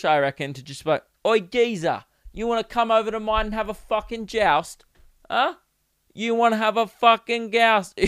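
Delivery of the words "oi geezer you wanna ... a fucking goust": put-on British accent, pitched up; higher British challenge to a joust; British geezer. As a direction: pick a fight as a hammed-up British geezer. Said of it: "it's very British".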